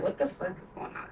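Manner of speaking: hushed whisper, breathy and unvoiced; whispered question. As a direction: whisper it so the driver won't hear. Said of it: "she's like".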